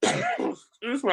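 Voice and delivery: coughing while talking; clearing a choke mid-sentence; choking. Real, not acted. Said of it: "I'm choking".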